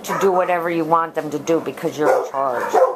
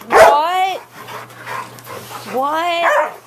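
high loud squeal of surprise; excited; 13 semitones higher, 6 dB louder than usual. Real, not acted. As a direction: squeal it out in delight. Excited greeting.

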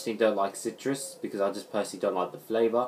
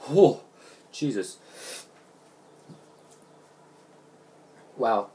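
sour drink reaction; 3 dB louder, slower than usual. Real, not acted. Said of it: "Oh, that's really sour".

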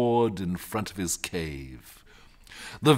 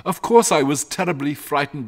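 raised, agitated shepherd voice; frightened shepherd; 5 semitones higher, 7 dB louder than usual. Acted. Shepherd admitting his fear to his family, agitated. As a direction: confess the fright in a high, loud voice.

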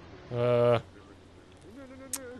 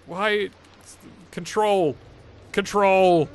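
loud exasperated yell, higher; angry shout; 6 semitones higher, 8 dB louder, slower than usual. Real, not acted. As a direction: yell at the controls in frustration. Yelling at game controls.

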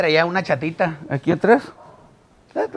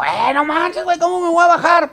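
much higher, louder frantic protest; panicked complaint; 5 dB louder than usual. Retold or imitated. Surfer panics at the magician about his giant board, high and loud.

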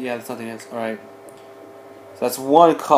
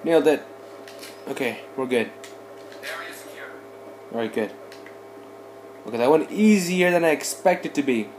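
ordinary talk

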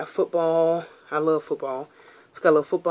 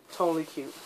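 usual speaking voice

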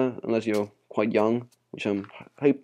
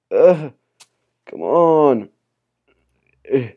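frustrated groan; 4 semitones higher, 8 dB louder, much slower than usual. Real, not acted. Frustrated at game.

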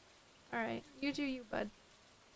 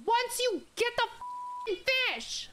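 frustrated yell; 11 semitones higher, slower than usual. Real, not acted. Gamer yelling at a fish that won't bite, real frustrated yell. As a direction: yell at the stubborn fish, fed up. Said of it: "I'm sorry I yelled".

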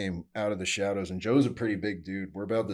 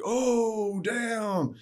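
high, drawn-out mock crowd cry; loud, high-pitched mock astonishment; crowd reaction; 14 semitones higher, 4 dB louder than usual. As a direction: imitate a shocked crowd reacting to a stunt. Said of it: "the whole crowd, like, one of those".

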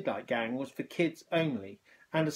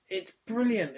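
higher, bright burst of delight; excited exclamation; 7 semitones higher than usual. A narrator's reading. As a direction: exclaim it with joy. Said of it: "exclaimed Amber".

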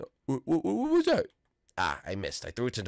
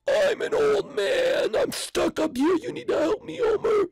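put-on high old man voice. The old man stuck in the tree calling Homer, high shouting. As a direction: wail it like a stuck grandpa.

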